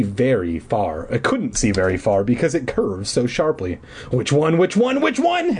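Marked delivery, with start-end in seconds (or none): 4.1-4.6 plain. A narrator's reading. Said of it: "Eddie repeated". 4.6-5.6 much higher, frantic last repeat; rising panic. A narrator's reading. Eddie, at the fork, frantically repeating. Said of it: "Eddie repeated".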